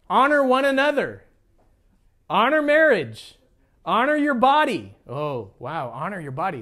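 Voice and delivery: usual speaking voice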